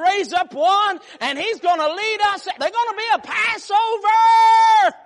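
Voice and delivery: loud, high preaching shout; preaching at full force. Real, not acted. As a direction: build up to a shout.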